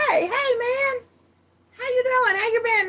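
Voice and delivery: high, cheery mock voice of a guy greeting a friend; friendly greeting. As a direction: greet your buddy in a high, bright put-on voice.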